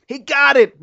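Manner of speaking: high, loud cry of victory; triumphant outburst. Acted. Husband cheering as the neighbor silences the alarm, excited shout. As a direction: burst in cheering as the alarm stops. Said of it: "broke in Phil".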